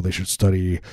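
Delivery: normal talking voice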